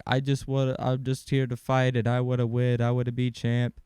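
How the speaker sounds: a light imitation of a deaf fighter's speech; imitating a deaf fighter. Deaf fighter interviewed after a win, light imitation. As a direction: mimic the fighter in his post-fight interview. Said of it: "he talks like a deaf guy".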